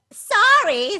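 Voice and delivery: high loud startled sorry. Acted. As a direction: yelp the apology. Dragon apologizes in fright.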